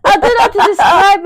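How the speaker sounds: high giggly laugh through the words; breaking into laughter mid-sentence. Real, not acted. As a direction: laugh it out, can't keep a straight face. Laughing while describing parents.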